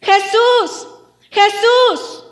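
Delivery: high, loud repeated yell; insistent full-voiced call. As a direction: keep crying out, refusing to be silenced. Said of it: "dice la Biblia que gritaba más fuerte".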